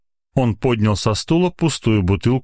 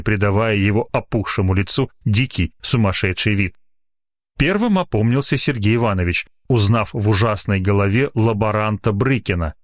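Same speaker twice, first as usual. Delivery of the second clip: plain reading voice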